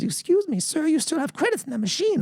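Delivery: high, prim lady's voice; polite lady. Retold or imitated. Casino lady reminds him of leftover credits, high and polite. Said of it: "She goes".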